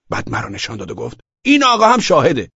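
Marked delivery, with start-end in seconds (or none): 1.4-2.5 high, raised voice pointing out a witness; loud, pitched-up insistence; loud accusing. A narrator's reading. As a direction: call it out insistently to the police.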